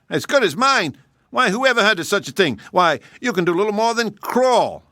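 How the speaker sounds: the proud hare bristling at an insult; scornful hare. Acted. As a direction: hare mocking the hedgehog's short legs. Hare scoffs.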